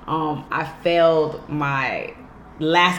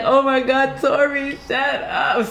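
mock exasperation; 8 semitones higher, slower than usual. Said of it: "You don't be like".

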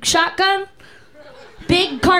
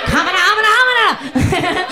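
higher, cartoonish hamana stammer; goofy babbling; 4 semitones higher than usual. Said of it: "And I'm like".

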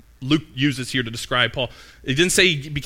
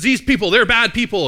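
higher, louder, confident crowd-stirring declaration; loud passionate rabble-rouser; 8 semitones higher, 6 dB louder than usual. Retold or imitated. A confident agitator tells the crowd, loud and passionate. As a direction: whip up the mob with certainty. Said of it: "really loud and passionate and confident".

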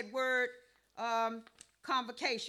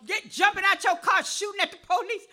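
raised, loud pulpit outcry; indignant preaching; 6 semitones higher, 8 dB louder than usual. Real, not acted. Preacher decrying shootings from moving cars, loud preaching. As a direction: exclaim it to the whole church.

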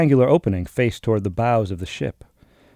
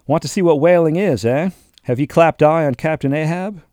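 mocking echo; 5 semitones higher, 5 dB louder than usual. A narrator's reading. Captain mocking a greenhorn's reason, skeptical echo. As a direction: echo his words back higher and louder, skeptical. Peleg mocks the answer.

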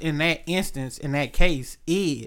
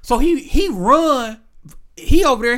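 ordinary delivery

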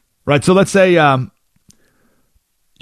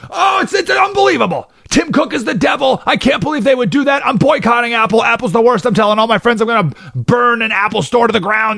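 over-the-top outraged shouting; mock outrage; 11 semitones higher than usual. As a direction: ham up a furious rant about Apple. Participant acting super outraged.

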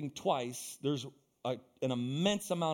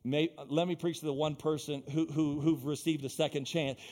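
normal talking voice

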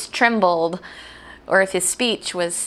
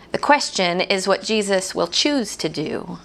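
ordinary delivery

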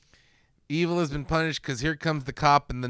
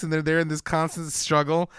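ordinary talk, nothing marked

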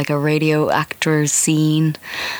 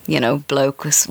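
ordinary talk, nothing marked

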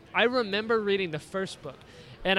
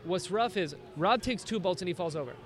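ordinary talk, nothing marked

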